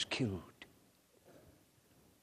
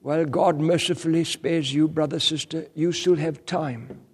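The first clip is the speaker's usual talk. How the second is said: loud, higher urgent exhortation; full-force preaching; 7 semitones higher, 8 dB louder than usual. Acted. Preacher urging brothers and sisters, loud and high. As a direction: press it on the congregation, pleading loudly.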